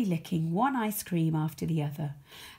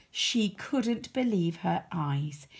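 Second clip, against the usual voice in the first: usual speaking voice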